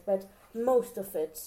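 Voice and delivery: ordinary talk, nothing marked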